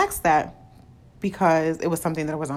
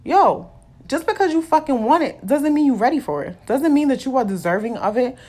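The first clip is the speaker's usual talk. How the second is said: higher, louder scolding of herself; firm, raised talk aimed at her own impatience; self-pep talk; 6 semitones higher, 5 dB louder than usual. Real, not acted. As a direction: shake yourself awake with it. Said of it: "trying to remind myself".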